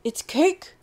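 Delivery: loud, high bark of a line; excited dog barking out the secret. Acted. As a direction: blurt it out like a bark. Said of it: "Kobe barked".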